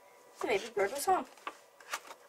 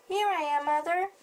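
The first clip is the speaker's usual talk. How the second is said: louder and higher, calling up to the plane; 9 semitones higher, 7 dB louder than usual. Acted. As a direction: shout up to the sky for mother. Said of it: "he called out".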